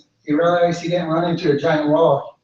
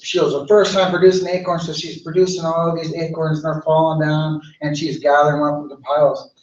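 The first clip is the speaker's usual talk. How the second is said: ordinary delivery, nothing marked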